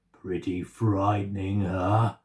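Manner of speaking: low, smug monster. A narrator's reading. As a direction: tease her, low and smug. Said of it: "said the monster".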